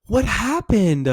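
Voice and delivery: high-pitched, intrigued questioning; exaggerated curiosity. Shown as a demonstration. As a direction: stretch the words, wide-open and inquisitive. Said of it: "it's a little exaggeratory".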